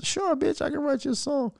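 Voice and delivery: high, soft mock-flirty voice as Tory. Tory answering a woman who wants a song, mock-flirty high voice. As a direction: purr it like a smug charmer.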